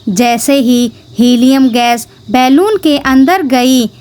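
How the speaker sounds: ordinary delivery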